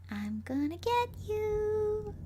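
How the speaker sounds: high, slow taunting call; sing-song call. Acted. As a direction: call out like a playful ghost. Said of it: "I call out".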